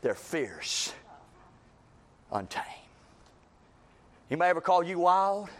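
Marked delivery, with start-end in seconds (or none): 0.0-2.7 hushed intensity. Acted. Pastor warning about wild church homes, hushed grave tone. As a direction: lower and softer, leaning on fierce. Dropped low intense delivery.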